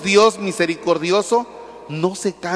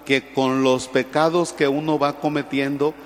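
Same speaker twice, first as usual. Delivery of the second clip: usual speaking voice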